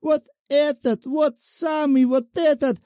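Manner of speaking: a whining widow threatening with her rights; defenceless petitioner. A narrator's reading. Shchukina voiced by narrator.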